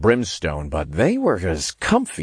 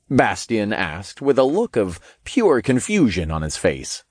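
plain reading voice

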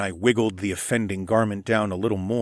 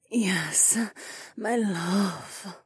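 lightly voiced sultry purr, higher; femme fatale; 11 semitones higher, 4 dB quieter, much slower than usual. A narrator's reading. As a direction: purr it like a seductress. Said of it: "she rumbled in her sultry voice".

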